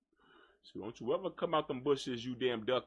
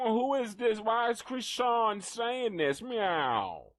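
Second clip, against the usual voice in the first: higher mock cat complaining; cat's voice; 5 semitones higher, slower than usual. Voicing the cat complaining about its food, higher mock whine. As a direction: speak for the hungry cat, whiny.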